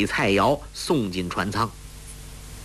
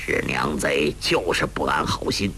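muttered curse; 7 semitones lower than usual. A narrator's reading. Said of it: "洪七公低声怒骂".

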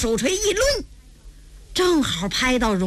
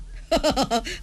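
amused laugh; 4 dB quieter than usual. Acted. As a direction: laugh briefly with delight. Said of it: "小云一看乐了". (乐得轻笑几声)

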